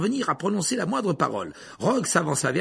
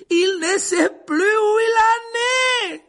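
loud, high, drawn-out shout; cried out; 8 dB louder, much slower than usual. A narrator's reading. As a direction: shout it to the whole class, stretching the words. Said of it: "s'écria Lupin". (cri fort, aigu et étiré)

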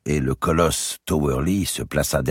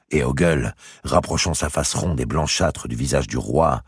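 usual speaking voice

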